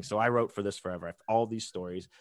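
usual speaking voice

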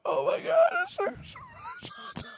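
very high squeal trailing into a thin squeak; mock fangirl shriek, fading away as it climbs; little-girl scream. Fan seeing Harrison Ford come out, screaming like a little girl. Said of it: "I'm screaming like a little girl".

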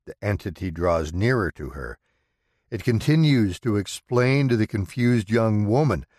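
plain reading voice

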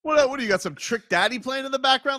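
amused mock disbelief at the Miami music; excited teasing. Real, not acted. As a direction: rib him loudly with a grin.

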